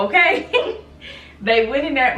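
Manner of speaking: bursting into laughter mid-story, pitch jumping up; laughing. Real, not acted. Telling viewers how stepdad broke the door, laughing. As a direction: laugh through it, loud and delighted. Gleeful retelling of the door break.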